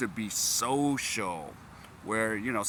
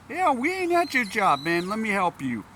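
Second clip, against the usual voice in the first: mock scolding; 11 semitones higher, 5 dB louder than usual. Speaker retells telling junior off.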